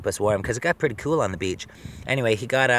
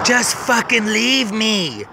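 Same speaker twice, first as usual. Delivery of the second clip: retold scream; 10 semitones higher, much slower than usual. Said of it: "screaming right in my face".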